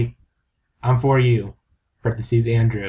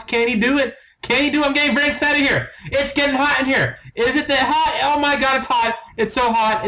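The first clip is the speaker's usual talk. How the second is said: rising ever higher, excited hype; mock announcer hype; 7 semitones higher than usual. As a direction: build the suspense like a frantic announcer. Mock sports-announcer hype building.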